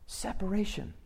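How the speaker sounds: a soft echo of Separation; quiet echo. Shown as a demonstration. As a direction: let the word Separation. drop away to a hush.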